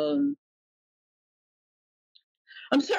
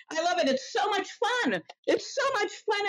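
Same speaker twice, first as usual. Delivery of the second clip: oversell the fun to mock it; mock delight, much higher; sarcastic; 8 semitones higher than usual. Real, not acted. Sarcastic about crashing out.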